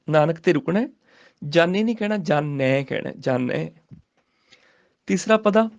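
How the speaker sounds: ordinary delivery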